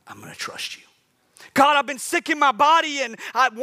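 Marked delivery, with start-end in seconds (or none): 0.1-0.8 whispered I'm gonna trust you; whispered surrender. Acted.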